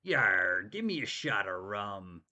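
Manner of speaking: growly low pirate, Yar drawn out. Retold or imitated. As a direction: play a gruff old sea pirate. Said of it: "and he's like".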